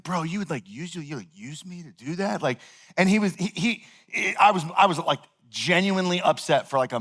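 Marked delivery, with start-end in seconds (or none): 0.0-2.4 much softer, wounded question; retold hurt, dropped to a soft voice; hurt and quiet. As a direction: say it small and betrayed. Hurt at being used.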